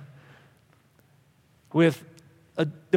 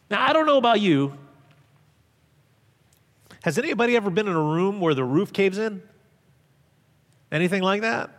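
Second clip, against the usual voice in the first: usual speaking voice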